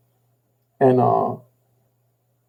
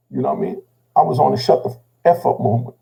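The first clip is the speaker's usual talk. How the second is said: plain reading voice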